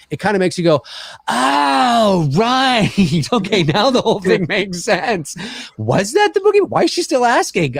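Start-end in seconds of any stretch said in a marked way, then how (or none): 1.3-7.8 higher, amused sudden understanding; mock realization. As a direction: act the penny dropping. Acted realization of viewer.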